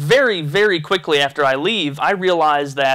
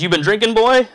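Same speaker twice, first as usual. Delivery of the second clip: lightly imitated stern cop, rising high and louder on the last word; stern police officer; 6 semitones higher, 3 dB louder than usual. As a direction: put on a cop's stern questioning, pitch climbing. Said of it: "looks at me kinda sternly".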